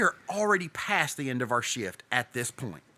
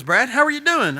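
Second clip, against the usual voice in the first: mock cheery boss; 11 semitones higher, 8 dB louder than usual. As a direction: boss greeting, much higher and louder. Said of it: "He calls and says".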